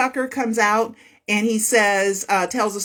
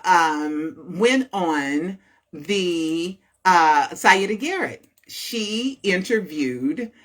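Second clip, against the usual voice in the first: ordinary delivery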